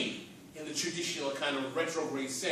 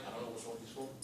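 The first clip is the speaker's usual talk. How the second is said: much softer, lower mock scorn; softer muttered mock; 8 semitones lower, 15 dB quieter, much faster than usual. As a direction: mutter it like a disdainful newcomer. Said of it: "we look at these brothers and sisters like".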